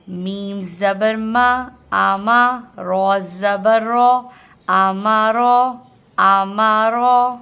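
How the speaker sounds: slow, loud, measured syllable chanting; spelling drill. Shown as a demonstration. Teacher leads learners through spelling two words, slow loud chant. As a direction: spell each letter, then join them with a held ending.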